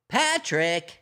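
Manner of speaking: lightly high cartoon sponge calling his friend; a hint of SpongeBob saying the name. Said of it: "I'm working on SpongeBob".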